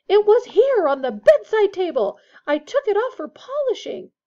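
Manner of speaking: high, loud indignant outburst; shouting. A narrator's reading. Percy accuses Ron over a missing badge, shouting. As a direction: shout in agitated protest. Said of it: "Percy was shouting".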